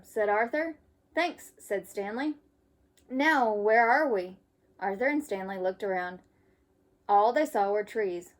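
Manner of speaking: lively storybook read-aloud; reading to children. Acted. Teacher reading a camping story to kids. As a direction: read it brightly for young listeners. Reads picture book to children.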